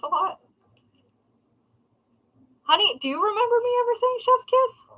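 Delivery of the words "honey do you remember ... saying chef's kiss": much higher, quick plea; high fast question. Real, not acted. Wife calling to her husband, high and fast. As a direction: ask the husband in a squeaky rush. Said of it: "asking husband off-mic".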